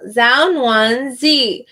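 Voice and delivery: "Zhao Nuan Zi" drawn out slowly, falling from "Zhao"; slow, careful name reading. Shown as a demonstration. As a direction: say "Zhao Nuan Zi" slowly, every syllable apart. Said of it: "gonna be wrong".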